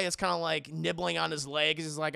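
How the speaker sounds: ordinary delivery, nothing marked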